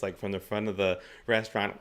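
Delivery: ordinary delivery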